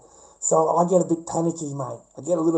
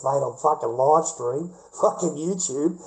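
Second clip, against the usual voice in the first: ordinary talk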